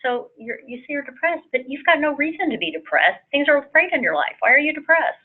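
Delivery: a hint of a doubting friend asking why you're sad; dismissive questioning. Speaker voicing a dismissive friend to someone depressed, mildly incredulous. Acted unhelpful remark to depressed person.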